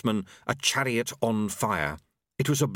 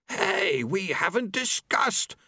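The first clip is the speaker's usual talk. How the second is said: high, drawn-out protest; protesting Famine; 9 semitones higher, slower than usual. A narrator's reading. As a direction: object in a high, put-out voice.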